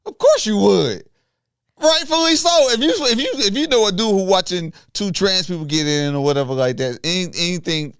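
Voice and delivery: very high, loud, worked-up argument; excited high-pitched insistence; heated protest. Real, not acted. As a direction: argue back loudly, voice shooting way up. Heated argument about being judged.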